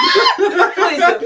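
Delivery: laughing hard, words squeezed out high and loud; real laughing reply. Real, not acted. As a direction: burst out laughing while you answer. Laughing at friend's crying joke.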